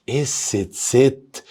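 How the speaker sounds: اس ست drawn out very slowly; slow model pronunciation. Shown as a demonstration. Teacher modelling the German letter name for Abu Hamid. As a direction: stretch اس ست sound by sound. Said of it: "أما الحرف ده يا أبو حميد".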